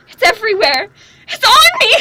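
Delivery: high, loud panicked cry; horrified cry. Acted. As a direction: shriek as the blood covers her. Seeing blood in her vision.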